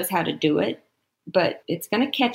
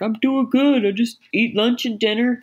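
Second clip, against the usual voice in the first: dad's voice; 4 semitones higher than usual. Retold or imitated. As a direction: put on your father's voice for his happy report. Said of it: "That's his voice".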